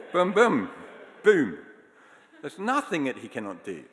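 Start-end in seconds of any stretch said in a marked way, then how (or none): 0.1-1.6 shouted boom, boom, boom; loud booms. Shown as a demonstration. Preacher bellows the song's booms to the congregation. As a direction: punch each boom hard. Kids' song action noises.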